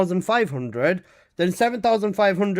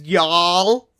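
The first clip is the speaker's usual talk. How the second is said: put-on American accent; 6 dB louder than usual. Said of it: "American accent for five minutes".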